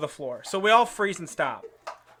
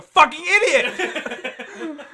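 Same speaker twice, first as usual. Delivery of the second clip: sudden higher, louder yell; mock angry outburst; 9 semitones higher, 6 dB louder, much slower than usual. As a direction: burst out of the ad read yelling. Mock ad erupts into yelling.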